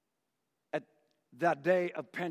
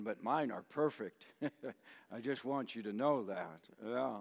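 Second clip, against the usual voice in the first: ordinary delivery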